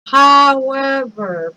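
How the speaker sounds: long, loud, stretched "However"; drawn-out caveat. Real, not acted. Warning listeners there is always a however, drawn out. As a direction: stretch the word right out to announce a catch.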